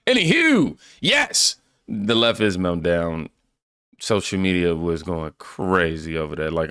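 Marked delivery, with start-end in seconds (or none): 0.1-1.6 very high, playful sing-out; high-pitched transition. Real, not acted. Host returning to the topic after a tangent, high-pitched. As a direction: pitch it way up to change the subject.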